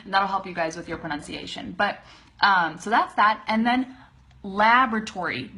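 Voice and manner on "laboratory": "laboratory" as "LAB-ruh-tory"; four-syllable "laboratory", punched at the front; front stress. Shown as a demonstration. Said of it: "emphasis is right there in the front".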